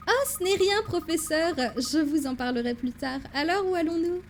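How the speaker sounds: breezy assistant. Acted. Assistant puts off the professor's question, high breezy voice. Voicing Amy brushing it off.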